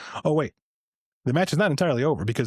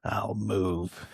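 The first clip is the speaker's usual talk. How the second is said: lightly voiced low answer in a story; old wrestler's punchline; 4 semitones lower, 6 dB quieter than usual. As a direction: imitate an old wrestler's curt reply.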